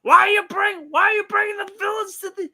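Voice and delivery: high, loud incredulous rant; Mad Dog impression. As Mad Dog scolding Spider-Man over the villains, high and loud. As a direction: yell at the hero like a radio host. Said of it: "and be like".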